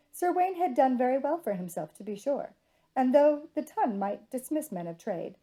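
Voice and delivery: usual speaking voice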